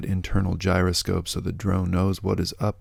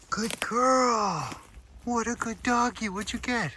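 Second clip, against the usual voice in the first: high-pitched dog praise; 4 dB quieter than usual. Real, not acted. Praising the dog after her find, in high squeaky baby talk. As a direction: gush over her like a proud owner.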